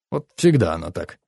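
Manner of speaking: low grumble; put-out father's low complaint; grumbling. A narrator's reading. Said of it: "проворчал папа".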